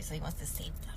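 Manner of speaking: whispered, dry sarcasm; sarcastic whisper. Real, not acted. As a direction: drop to a whisper, mocking. Sarcastic aside about Trump.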